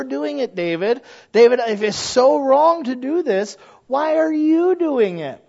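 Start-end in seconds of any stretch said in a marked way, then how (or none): 1.3-5.4 high, slow rising challenge; exasperated challenge. Acted. Preacher confronting David, high and slow. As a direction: press him hard on why.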